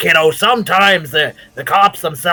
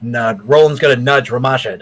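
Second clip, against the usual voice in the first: ordinary delivery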